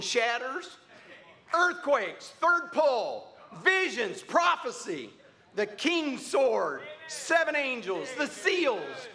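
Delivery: punched list, each item starting high and falling away; heralding preaching. Real, not acted. Preacher announcing today's message to the church, heralding list.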